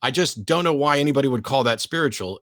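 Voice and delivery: ordinary talk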